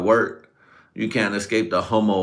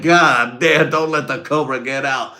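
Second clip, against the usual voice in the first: loud, high hyped-up cry; hyped exclamation; 5 semitones higher, 5 dB louder than usual. Retold or imitated. Boys hyping each other at work, loud excited shout. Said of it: "And we're like".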